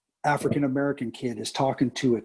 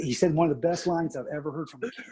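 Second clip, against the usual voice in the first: ordinary delivery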